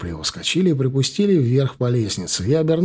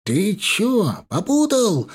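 much higher, hammed-up yell of a thug; a thug's high-pitched mock shout; mild shout; 8 semitones higher, much slower than usual. A narrator's reading. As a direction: yelp it high as he rushes in. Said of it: "закричал второй лысый".

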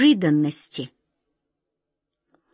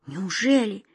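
hesitant, then rising in astonishment; trembling voice; 4 semitones higher than usual. Acted. Wizard near guessing the secret, with a wavering voice. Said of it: "дрогнувшим голосом проговорил волшебник Алёша".

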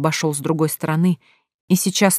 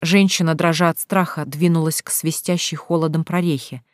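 plain reading voice

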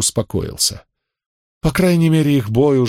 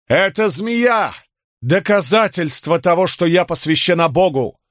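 proclaiming priestess; 7 semitones higher than usual. Acted.

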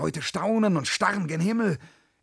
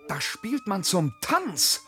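exhilarated; 5 semitones higher, 3 dB louder than usual. Acted. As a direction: get swept up by the dance. Hears dance music, fired up.

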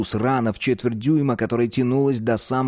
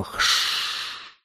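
hissing sound; 4 dB quieter than usual. Acted. Imitating water hiss.

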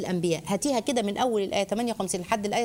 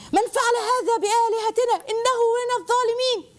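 mock outraged crowd; 11 semitones higher than usual. Angry idolaters demand who did it.

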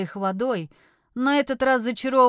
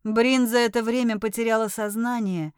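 usual speaking voice

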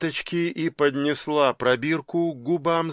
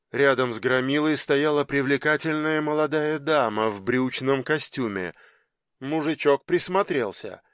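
plain reading voice

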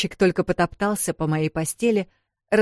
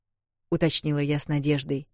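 ordinary delivery

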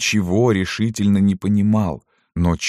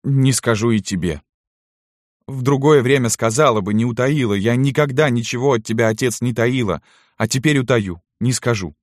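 put-on raised-pitch, resolute girl's voice; stubborn daughter; 6 semitones higher than usual. A narrator's reading. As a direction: refuse your father, standing firm. (упрямая дочь, повышенным голосом)

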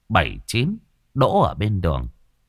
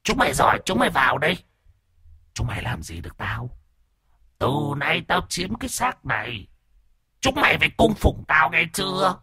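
higher, taunting spirit voice; defiant ghost voice; 7 semitones higher than usual. A narrator's reading. Possessing ghost taunts the family, defiant and eerie. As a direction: challenge them like a ghost owning the body. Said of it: "Âm thanh lại truyền ra thách thức".

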